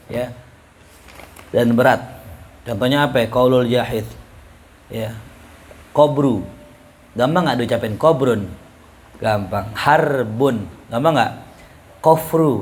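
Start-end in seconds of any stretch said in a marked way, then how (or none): none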